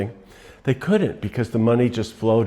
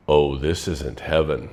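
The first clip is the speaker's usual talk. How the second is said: much lower, slow, ominous host voice; sinister reveal; 6 semitones lower than usual. Retold or imitated. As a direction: drop low and let the twist sink in. Twist reveal: host is devil.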